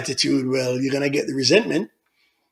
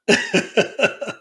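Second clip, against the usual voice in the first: laugh; 11 semitones higher than usual. Real, not acted. Host laughs at the comment.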